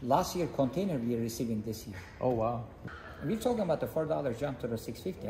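normal talking voice

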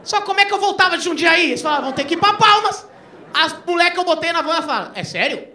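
high, loud, panicked storytelling; rising alarm, laughing through it; panicked excitement. Real, not acted. (pânico crescente, alto e agudo)

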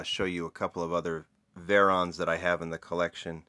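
ordinary delivery

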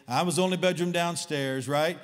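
normal talking voice

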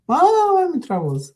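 very high "Mom", then a mock plea; child's fake call for mom. Child keeps calling mom in pretend trouble, high fake cry. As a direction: yell up to mom like a kid faking. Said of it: "So I kept calling".

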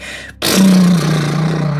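big long blow through loose lips; lip-shaking exhale. Shown as a demonstration. Guide showing an expressive breath out, lip-shaking exhale. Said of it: "sound as if you're shaking your lips".

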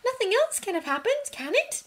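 put-on high anxious girl's voice; worried girl. A narrator's reading. Hermione voiced in story.

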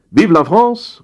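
high-pitched patriotic cheer; called-out cheer. A narrator's reading. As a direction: cry it out like generals answering their leader. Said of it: "riefen die Marschälle und Generäle".

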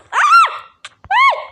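sudden high, loud scream of alarm; shocked scream. Real, not acted. Gamer getting hit by the dragon screams in alarm. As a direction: yelp as if hit out of nowhere.

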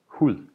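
"hud" ending in a soft D, no hard stop; soft Danish D. Shown as a demonstration. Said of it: "Notice that both".

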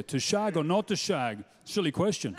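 Sean Connery. Acted. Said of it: "Why can't there be a Sean Connery Shakespeare?".

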